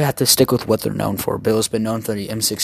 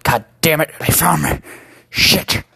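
gruff cursing soldier, raised voice; 5 semitones higher, 5 dB louder than usual. Acted. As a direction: curse like a cornered old soldier. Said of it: "He's like".

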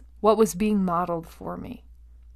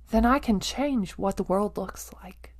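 whispered; 5 dB quieter than usual. Real, not acted. Intimate point about changing your world.

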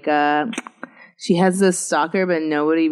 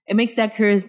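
ordinary delivery

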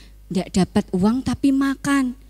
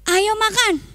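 nagging mom; 11 semitones higher than usual. Acted. Parent telling child to eat.

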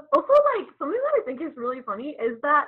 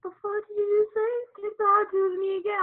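high, fast mock telling-off; confronting parent; 10 semitones higher than usual. Parent reacting to the slightest thing, mock outrage. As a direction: imitate a parent flaring up at nothing. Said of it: "slightest thing to be like".

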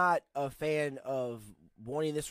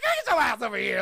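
loud, high mock command; playful demanding shout; 8 semitones higher, 10 dB louder than usual. Man pulling his girlfriend close, playful shouting. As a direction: holler it as a teasing order.

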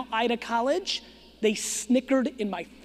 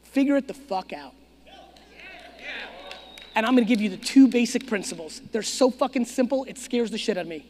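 usual speaking voice

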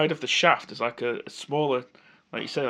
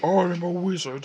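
wizard impression; 4 semitones higher, slower than usual. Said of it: "it's my impression of a wizard".

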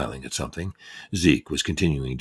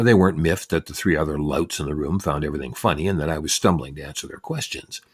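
ordinary delivery, nothing marked

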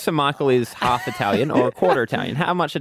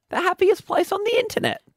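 mock bright tagline. Jokey tagline delivery.

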